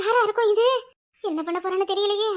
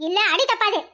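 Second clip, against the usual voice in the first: usual speaking voice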